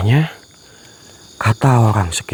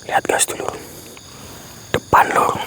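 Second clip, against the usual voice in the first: urgent hushed whisper; 6 dB quieter than usual. Real, not acted. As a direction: whisper it so the figure won't hear. Whispering on sighting a figure. (berbisik kepada penonton)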